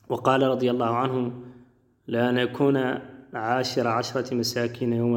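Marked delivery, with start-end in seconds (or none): none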